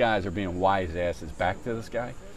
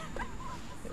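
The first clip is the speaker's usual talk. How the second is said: soft, high breath of a laugh; real laughter; 16 dB quieter than usual. Real, not acted. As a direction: laugh quietly at your own impression. Laughs at own impression.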